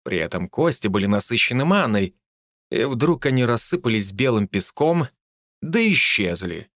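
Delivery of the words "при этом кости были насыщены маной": usual speaking voice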